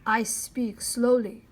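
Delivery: tiny warrior grunting slowly. A narrator's reading. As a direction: grunt each word out slowly. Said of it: "grunted the miniature Indian at last".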